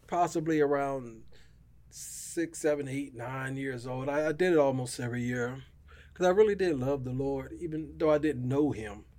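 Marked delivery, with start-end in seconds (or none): none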